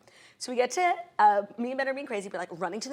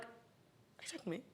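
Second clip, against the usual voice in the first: tiny, slow whispered question; stunned whisper; 19 dB quieter than usual. Retold or imitated. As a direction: whisper it as if you can't believe he means you. Retold stunned reply to agent.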